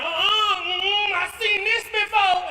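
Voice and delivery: high, loud refusal; alarmed departing couple. Acted. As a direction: refuse in a high voice while getting out.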